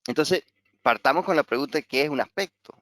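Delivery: normal talking voice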